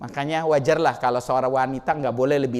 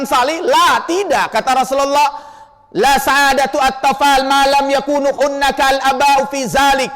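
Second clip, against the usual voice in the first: loud, raised sermon delivery; fiery preaching; 8 semitones higher, 6 dB louder than usual. Acted. Preacher urging fathers to pray for their children, loud and fervent. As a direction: drive the point home to the congregation.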